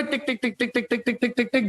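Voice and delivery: string of short, even "tick" sounds; imitated ticking. Shown as a demonstration. Teacher moving the particle to point P, imitated ticking. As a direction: tick along step by step like a clock.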